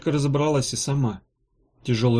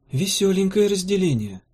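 higher-pitched musing remark; a touch of wry pondering; thoughtful; 7 semitones higher than usual. A narrator's reading. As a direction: say it pensively, pitched up. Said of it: "задумчиво произнесла Ирка".

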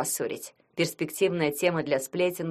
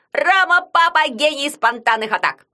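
sports commentator; 8 semitones higher, 8 dB louder than usual. Acted. Yagun commenting the match.

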